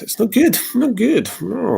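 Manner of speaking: high, thin dismay; high lament. Real, not acted. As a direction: say it high-pitched, shaking off bad news. Lamenting news of a death.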